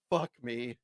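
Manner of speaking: usual speaking voice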